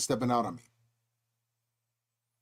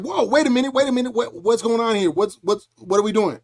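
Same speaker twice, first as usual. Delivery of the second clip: high, louder flustered protest; mock alarm; 5 semitones higher, 5 dB louder than usual. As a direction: act caught off guard, words tumbling over each other. Acts being hit by whirlwind.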